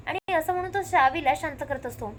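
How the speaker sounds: ordinary talk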